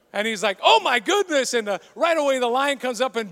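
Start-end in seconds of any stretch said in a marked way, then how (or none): 0.6-1.5 alarmed gasp. Acted. Man lands in lion cage.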